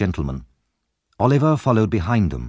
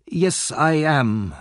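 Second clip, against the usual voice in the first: put-on slow, higher-pitched old gentleman; hesitant old gentleman; 5 semitones higher, much slower than usual. A narrator's reading. Old gentleman answering the officer, hesitant. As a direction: answer slowly, drawing it out.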